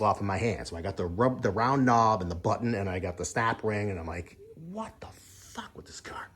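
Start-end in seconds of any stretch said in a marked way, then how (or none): 4.6-6.3 hushed, breathless whisper of disbelief; a stunned whispered swear; whispered curse. Retold or imitated. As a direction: whisper it under your breath, fed up. Alone, cursing at the broken car.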